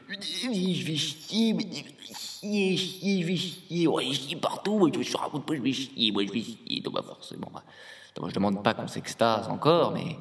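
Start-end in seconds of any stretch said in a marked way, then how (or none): 0.1-6.8 high, drawn-out caricature easing down toward normal pitch; mock grown-ups. Grown-ups announcing a toilet trip, mocking put-on high voice. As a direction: whine it slowly in a put-on voice, then let it settle. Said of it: "vous devenez".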